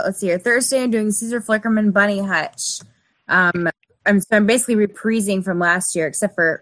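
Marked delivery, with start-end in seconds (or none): 4.8-5.4 "reprising" said re-PREE-zing; mispronounced. Real, not acted. Cosplayer listing her plans, mispronouncing it re-PREE-zing. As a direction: say "reprising" with an EE in the middle. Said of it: "I don't know why I said reprising".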